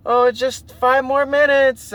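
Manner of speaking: put-on high, slow girly voice; lightly voiced girlfriend.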